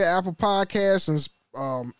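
normal talking voice

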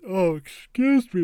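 talking through a yawn; mid-yawn, stretched out words. Real, not acted. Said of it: "the big yawn of the day".

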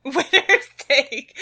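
slow, high echo through laughter; laughing, drawn-out repeat; amused mimicry. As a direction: mimic the slogan while cracking up. GM laughingly echoes player's odd slogan.

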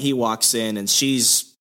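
ordinary delivery

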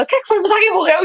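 mãe aflita em voz muito mais aguda, imitada de leve; imitando a mãe. Retold or imitated. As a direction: arremedo leve de mãe preocupada. A mãe pergunta o que houve. (imitação leve da mãe, aguda e aflita)

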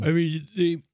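talking through a yawn; words pulled out by a big yawn. Real, not acted. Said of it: "sorry for the yawning".

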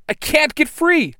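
very high, loud cry; trapped yell. A narrator's reading. Blue Ranger pinned down by fighters, high alarmed yell. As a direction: call out in helpless alarm. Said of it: "the Blue Ranger yells".

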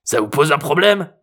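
high, cranky challenge; menacing old man. A narrator's reading. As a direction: challenge the cop as a feisty grandpa. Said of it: "un doigt menaçant".

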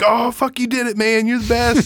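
high, thrilled stoner buddy voice; mock-excited high voice. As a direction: gush like an overjoyed friend. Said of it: "and he's like".

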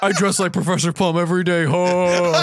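lightly voiced goofy mimicry with laughs; mock impression of his cohost. Teasing his cohost with a goofy impersonation of him. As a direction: play a pompous tall guy, half laughing. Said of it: "Spot on impersonation".

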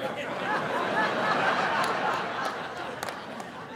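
a high, breathy burst of real laughter. Real, not acted. Storyteller laughing at his own tears after fights, real laugh.

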